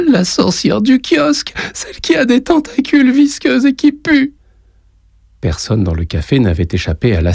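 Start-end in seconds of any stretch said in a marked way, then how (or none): 0.1-4.3 put-on much higher child's voice, breathless fear; a boy telling grandpa about the monster; terrified little boy. A narrator's reading. Boy describes the witch. (petit garçon terrifié, voix très aiguë)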